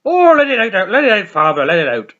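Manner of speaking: loud, high, pleading encouragement; group leader urging. Retold or imitated. AA group leader to Father Jack, loud and pleading. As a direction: coax the old priest to let it all out. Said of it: "and he's like".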